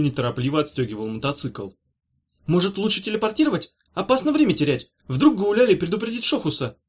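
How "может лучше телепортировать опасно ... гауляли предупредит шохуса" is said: put-on high, anxious young voice; a hint of worried Kornelij; mild worry. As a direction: fret about losing time. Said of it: "забеспокоился Корнелий".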